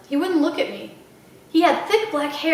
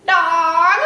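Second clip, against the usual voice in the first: louder squawked croak; parrot croak; 4 semitones higher, 6 dB louder than usual. Acted. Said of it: "she croaked".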